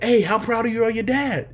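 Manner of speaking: high, loud reporter question; eager reporter. Retold or imitated. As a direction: push the mic forward and ask brightly. Reporter voiced in the story.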